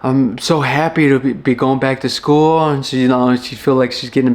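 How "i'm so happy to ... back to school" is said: imitating sister's happy relief; mildly excited, lifted on the opening. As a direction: retell her gushing with a touch of joy. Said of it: "She's excited".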